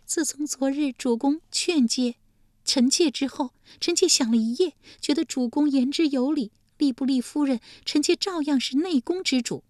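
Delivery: high, piqued court lady; high consort in a huff; sulky consort. Acted. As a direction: pout it out high. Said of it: "丽姬气道".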